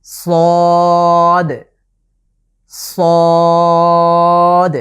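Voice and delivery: long, thick, full-voiced সদ; Arabic letter sad. Shown as a demonstration. Teacher demonstrating the letter to viewers, a loud drawn-out সদ. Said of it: "পরবর্তী যে letter আমরা দেখতে পাচ্ছি".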